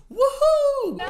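high, loud whoop; mock scream. YouTuber mimics the cyclist screaming as rim fails, mock scream. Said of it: "Now he screams".

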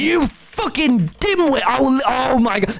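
high, slow exasperated rant; fed-up passenger berating the driver; mock furious insult. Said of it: "And I'm going".

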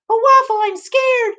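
very high, loud kiddie squeal; scared little one. Acted. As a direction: whimsical falsetto fright. Tiny Pancake frightened.

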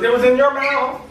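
high put-on freaked-out voice; mock alarm. Retold punchline, acted voice.

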